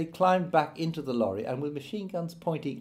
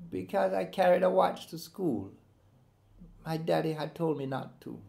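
put-on small boy's voice; 5 semitones higher than usual. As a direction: speak like a hurt eleven-year-old. Boy explains the welts.

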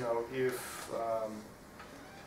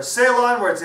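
high, loud rallying shout; Vasco calling back; 11 semitones higher, 15 dB louder than usual. Acted. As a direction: call out the order at full voice. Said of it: "answered Vasco".

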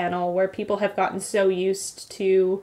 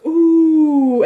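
loud, high drawn-out oooh; audience "ooh"; 7 semitones higher, 10 dB louder than usual. Retold or imitated. Retelling the audience's reaction to a joke, mock crowd oooh. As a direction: imitate a teasing crowd going ooh. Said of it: "Everyone goes".

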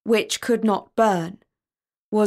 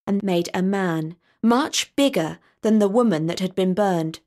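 usual speaking voice

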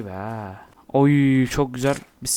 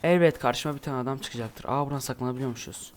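usual speaking voice

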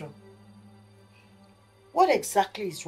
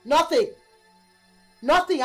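angry echo; 8 dB louder than usual. Acted. Mother mocking reply angrily.

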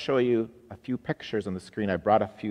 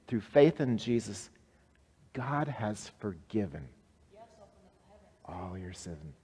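normal talking voice

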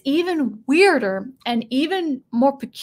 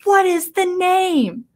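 high, loud, slow question shoved in his face; demanding shout; 8 semitones higher, 4 dB louder, slower than usual. Retold or imitated. Ahsoka in the dying man's face, slow loud demand. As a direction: demand it, drawing out every word. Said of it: "and says".

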